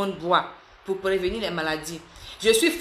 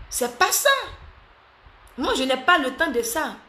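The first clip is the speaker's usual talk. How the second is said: high emphatic denial; 7 semitones higher than usual. Real, not acted.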